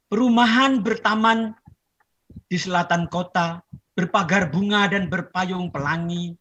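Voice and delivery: measured poem reading with long pauses; poetry recitation. Acted. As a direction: declaim verse, each line falling gently to rest. Recites second poem to audience.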